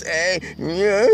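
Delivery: high, odd mock voice; imitating his talk. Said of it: "he was talking like this".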